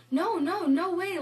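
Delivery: high, loud cry of no way; disbelieving no, no, no way; shocked disbelief. Retold or imitated. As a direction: blurt it out in stunned denial. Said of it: "Like, I was shocked".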